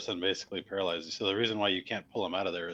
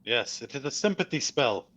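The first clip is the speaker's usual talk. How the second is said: raised, higher-pitched voice; calling out; 6 semitones higher, 4 dB louder than usual. Acted. Casimir identifying the spell for the party, calling out. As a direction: project it to someone far off. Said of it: "so he can shout at you".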